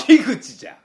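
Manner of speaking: sudden loud, high comic retort; tsukkomi retort. Acted. Comedian snaps back at a wrong-name greeting, loud tsukkomi. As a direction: shout it as a comeback.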